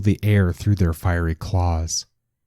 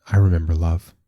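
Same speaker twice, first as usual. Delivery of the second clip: flat. A narrator's reading. Sister reassures the child she remembers, flat. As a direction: answer tonelessly, keeping it level. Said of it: "Here, the same tone again".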